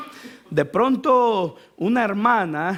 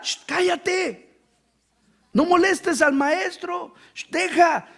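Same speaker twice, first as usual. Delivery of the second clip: high, slow shushing crowd telling him to hush; 6 semitones higher, slower than usual. Retold or imitated. Crowd hushing Bartimeo, high and slow. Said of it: "la gente le decía".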